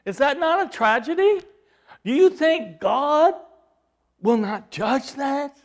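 high, loud pulpit cry; impassioned preacher's challenge; full-force preaching. Acted.

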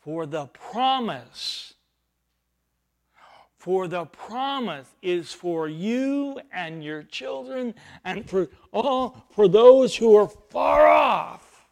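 slow build to a loud ringing cry; slow and swelling up to a loud cry; proclaiming scripture. Retold or imitated. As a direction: rise like a prophet calling out. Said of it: "then Peter says".

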